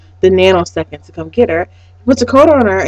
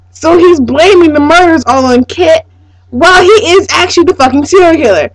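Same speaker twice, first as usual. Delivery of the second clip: outraged rant; 7 semitones higher, 5 dB louder than usual. Real, not acted. Fan ranting at the villain.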